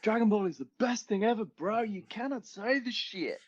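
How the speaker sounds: put-on a kid fan's rant in a failed Australian accent; bad Australian accent. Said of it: "my attempt at an Australian accent".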